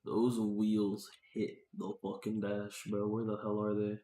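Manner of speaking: usual speaking voice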